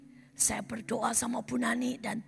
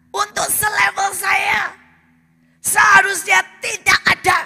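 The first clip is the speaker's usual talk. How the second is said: higher, much louder shouted accusation; God's rebuke; 8 semitones higher, 16 dB louder than usual. Acted. God accusing the proud pastor, shouted rebuke. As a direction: hammer each charge like thunder. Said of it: "Tuhan berkata".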